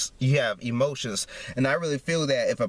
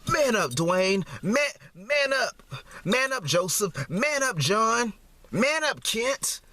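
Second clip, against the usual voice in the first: higher, slow taunting mimicry of bullies; mocking taunt; 7 semitones higher, slower than usual. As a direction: sneer it slowly, up high, like a schoolyard taunt. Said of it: "they say".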